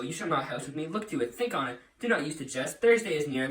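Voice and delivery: ordinary talk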